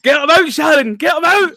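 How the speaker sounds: high, very loud yell; hammed-up high shout; mock shouting. Acted shouted line in accent game.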